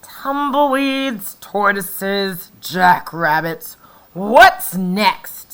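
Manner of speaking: loud, slow exasperated complaint; grumpy outburst. Acted. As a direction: list them with rising annoyance.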